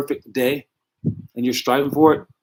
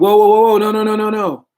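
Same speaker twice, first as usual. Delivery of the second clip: much higher, louder whoa, no, no, no; alarmed protest; 8 semitones higher, 5 dB louder than usual. Pretend. Parents deny pushing the kid, alarmed loud protest. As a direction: throw up your hands in shocked denial.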